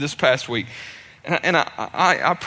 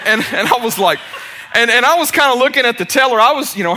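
ordinary delivery

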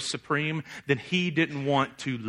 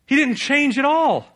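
emphatic; 11 semitones higher, 8 dB louder than usual. Real, not acted. Preacher hammers Jonah's failure.

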